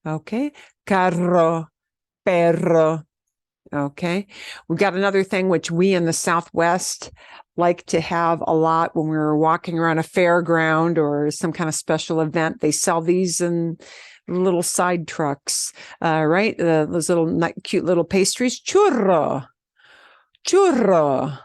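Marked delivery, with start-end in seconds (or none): 0.9-3.0 carro and perro both with trilled rr; rolled r. Shown as a demonstration. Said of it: "doing a strong trill does make a difference". 18.6-21.4 churro with a trilled rr; rolled r. Shown as a demonstration. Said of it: "Churro is a super popular pastry item".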